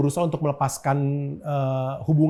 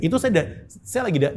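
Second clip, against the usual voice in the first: ordinary talk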